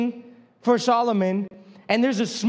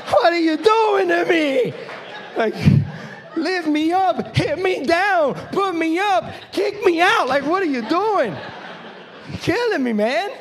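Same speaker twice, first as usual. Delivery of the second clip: higher, louder, laughing complaint; laughing exasperation; 6 semitones higher, 4 dB louder, slower than usual. Retold or imitated. Pastor telling the crowd about a backhanded compliment, laughing mock protest. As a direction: plead in mock despair, laughing.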